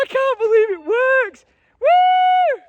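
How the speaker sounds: high, loud, slowed-down cry of joy; real triumphant yell; excited shout. Real, not acted. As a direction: shout it with delight. Cheering lit tree.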